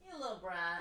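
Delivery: put-on high, soft baby-talk scold. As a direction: coo it at the cat in a tiny voice. Scolding the cat again.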